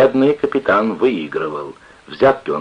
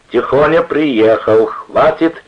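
loud, higher, insistent shouting; clamouring; 7 semitones higher, 6 dB louder, slower than usual. A narrator's reading. Gang to losing card player, clamouring. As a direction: shout to stop the game. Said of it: "закричали все наперебой".

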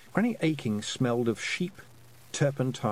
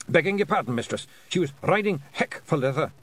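higher, louder wee Scots warrior; Scottish pixie voice; 7 semitones higher, 3 dB louder than usual. Acted. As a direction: play a tiny Scottish fairy man. Hamish apologises sheepishly.

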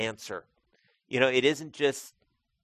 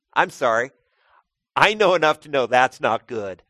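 louder, laughing through the words; laughing apology; 11 dB louder than usual. Real, not acted. Preacher laughs at his own joke to the congregation, loud and laughing. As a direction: laugh it off, then own the point.

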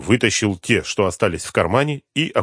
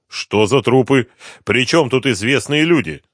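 raised, forceful questioning; mild angry yelling; 5 semitones higher, 5 dB louder than usual. A narrator's reading. Inspector interrogates the addict about bodies, restrained yelling. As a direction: press him with a contained yell. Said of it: "продолжал орать инспектор".

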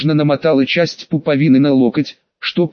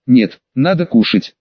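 plain reading voice

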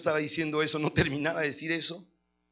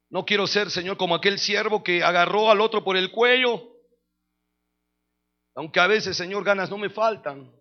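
raised-voice, fervent public prayer; oración; 2 semitones higher, 7 dB louder than usual. Real, not acted. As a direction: address God earnestly before the congregation. Dirige una oración en voz alta. (oración ferviente en voz alta)

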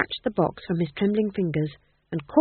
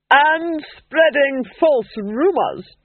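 loud cold slow interrogator; menacing noblewoman questioning a witch; cold Duchess; 8 semitones higher, 7 dB louder, much slower than usual. A narrator's reading.

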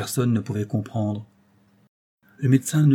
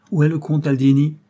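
louder, higher abrupt question; brusque demand; 5 semitones higher, 6 dB louder than usual. A narrator's reading. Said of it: "demanda d'un ton brusque". (question posée d'un ton brusque)

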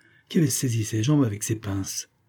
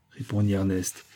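ordinary delivery, nothing marked

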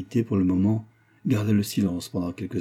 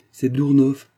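raised, louder angry accusation; carried-away anger; 5 semitones higher, 8 dB louder, slower than usual. A narrator's reading. Mother blaming Dournoff for the family's misfortune, angry. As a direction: accuse him in rising anger. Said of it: "emportée par sa colère".

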